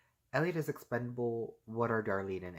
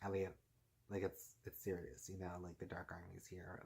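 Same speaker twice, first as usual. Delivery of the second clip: urgent hushed warning; 3 semitones lower, 9 dB quieter than usual. Retold or imitated. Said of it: "Darlene's kinda just like".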